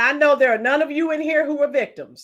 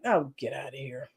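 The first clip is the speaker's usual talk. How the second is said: much lower and softer, scoffing; dismissive scoff; 13 semitones lower, 11 dB quieter than usual. Real, not acted. Host brushing off the parents' excuse, scoffing low. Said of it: "dismissing the parents' excuse".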